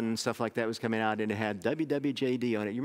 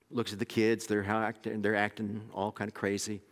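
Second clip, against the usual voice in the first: ordinary delivery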